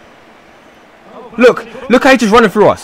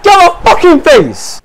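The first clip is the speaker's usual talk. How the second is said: real angry shouting, pitched way up; furious rant; 9 semitones higher than usual. Real, not acted. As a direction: rage at the team's awful defending. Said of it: "I'm so fucking angry right now".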